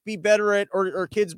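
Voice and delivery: ordinary talk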